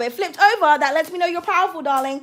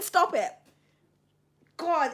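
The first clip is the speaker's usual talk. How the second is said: ordinary delivery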